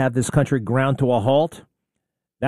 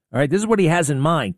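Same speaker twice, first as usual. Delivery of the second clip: usual speaking voice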